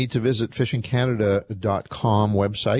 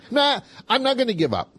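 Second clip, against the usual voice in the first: determined; 12 semitones higher than usual. Acted. Mock resolve, big pitch jump.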